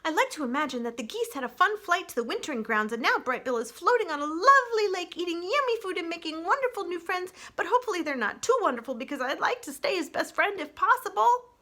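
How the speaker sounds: high, bright-eyed little chatterbox; high, wistful young critter; chatty young opossum. Acted. Chit-Chat character voice.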